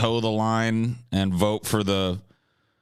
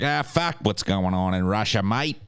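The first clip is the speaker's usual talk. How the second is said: put-on Australian, rising higher partway through; Aussie accent; 4 semitones higher than usual. Imitating an Aussie unbothered by Russia, broad Australian accent. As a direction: shrug it off as a mock Aussie. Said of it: "Only that Aussie can be like".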